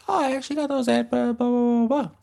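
very high, fast airy voice; squeaky quick barista voice; chatty barista. Retold or imitated. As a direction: rattle it off like a breezy hipster. Imitating barista.

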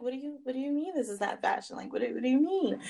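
high, fast, stunned "what do you mean"; stunned disbelief. Retold or imitated. Stylist questions the photographer, stunned and high. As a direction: ask it in quick shocked disbelief. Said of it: "literally my heart sunk".

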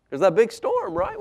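plain reading voice

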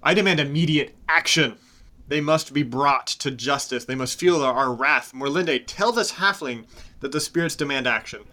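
an enraged elder hammering on for vengeance; Cyanidal spitting back. Acted. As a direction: spit every word of the demand at them. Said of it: "And Cyanidal just spits back".

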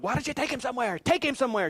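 high-pitched urgent demand, retold; high, pressing mock panic; acted-out frantic plea. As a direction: replay a frantic father pleading. Acts out frantic exchange with wife.

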